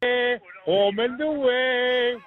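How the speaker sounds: put-on a light New Zealand accent, stretched slowly; mock Kiwi accent. Caller greeting the New Zealand listeners in a light mock Kiwi accent. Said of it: "show my best Kiwi accent".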